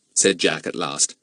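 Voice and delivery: ordinary delivery, nothing marked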